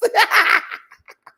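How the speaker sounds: loud high cackle. Real, not acted. Laughing at Lux.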